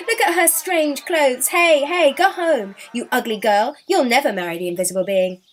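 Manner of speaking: loud, high jeering with laughter in it; mock her, laughing as you shout; laughing taunt. Villagers chasing the girl off, loud laughing taunts. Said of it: "they laughed".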